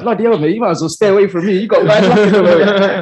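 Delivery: high, fast, laughing mock warning; laughing friend's reply. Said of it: "He's like".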